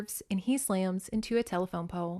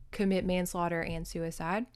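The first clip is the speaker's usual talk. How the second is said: normal talking voice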